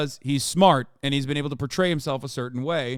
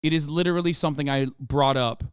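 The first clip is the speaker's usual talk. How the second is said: ordinary talk, nothing marked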